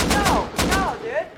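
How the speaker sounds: loud, high-pitched protest; dismayed shout. Real, not acted. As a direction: cry out in disbelief at the loss. Dismay at game setback.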